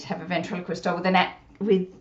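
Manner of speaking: ordinary talk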